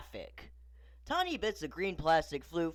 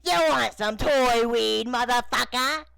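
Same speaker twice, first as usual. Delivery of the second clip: aggressive rapper doll, high and loud; put-on rapper voice, aggressive shouted offer; 8 semitones higher, 8 dB louder than usual. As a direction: push it louder, slow and drawn out. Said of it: "growing aggressive".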